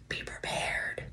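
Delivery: drawn-out, hushed whisper; whispered warning. Pretend. Host teasing listeners about the episode, whispered warning.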